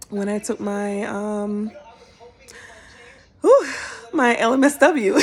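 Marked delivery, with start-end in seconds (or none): 3.4-5.2 laughing relief. Real, not acted.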